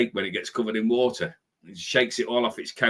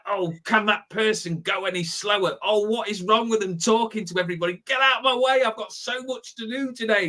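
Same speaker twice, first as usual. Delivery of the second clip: playing your own impatient self, pitched high; mock impatience; 10 semitones higher than usual. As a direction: huff and whine at the person ahead. Mocking impatient self in queue.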